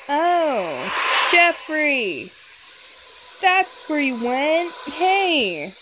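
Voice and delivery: loud, high, drawn-out holler; calling out. Real, not acted. Player greeting Jeffrey on finding him, loud surprised call.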